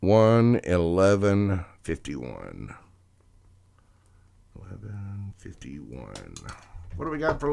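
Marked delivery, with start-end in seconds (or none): 0.1-2.8 slow, loud time call; loud announcement. Real, not acted. Reader announcing the time for Leo, loud and slow. 4.6-6.4 slow, very soft repeat; hushed echo. Real, not acted. Reader repeating the time for Leo, hushed and slow.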